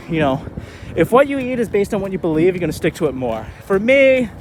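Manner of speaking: ordinary talk, nothing marked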